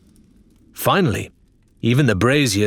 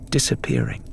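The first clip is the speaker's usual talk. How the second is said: whispered fade; 8 semitones lower than usual. A narrator's reading. Narrator lets the map lines vanish, whispered. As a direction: let the word vanish.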